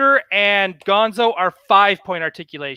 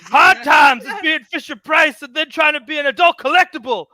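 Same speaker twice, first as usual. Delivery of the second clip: loud, higher wrestling promo rant; Dusty Rhodes promo; 5 semitones higher, 4 dB louder than usual. Retold or imitated. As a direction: shout it like a wrestler's hard times promo. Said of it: "cutting a Dusty Rhodes Hard Times promo".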